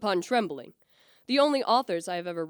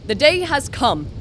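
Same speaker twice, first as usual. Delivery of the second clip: solemn triumph; 4 semitones higher, 6 dB louder than usual. Acted. Victor begins his great experiment.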